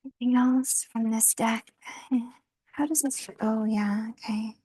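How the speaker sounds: whispered aside to herself; hushed question, no voice; whispering while handling the deck. Real, not acted. Quiet aside while shuffling cards.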